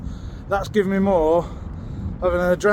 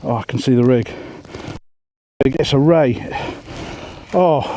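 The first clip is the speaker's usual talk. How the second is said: ordinary talk, nothing marked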